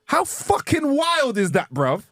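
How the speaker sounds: very high, drawn-out incredulity; amazed disbelief. Real, not acted. Viewer retells tiger scene, high amazed disbelief. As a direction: marvel at it, pitch soaring.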